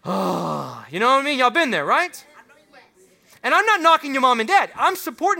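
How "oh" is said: mock dismayed gasp. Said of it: "you're like".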